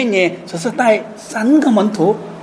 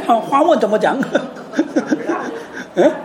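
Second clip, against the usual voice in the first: ordinary talk, nothing marked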